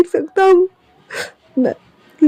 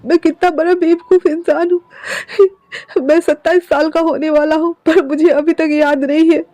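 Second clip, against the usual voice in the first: higher, sobbing, despairing pleas; desperate crying self-blame; weeping apology; 6 semitones higher than usual. Acted. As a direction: break down begging forgiveness. Wing weeps at the stone.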